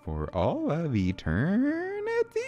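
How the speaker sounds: slow, eerie princess swooping upward; slow, unsettling royal questioning; creepy Princess Peach. Acted. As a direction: pose the menace slowly, sliding up in pitch.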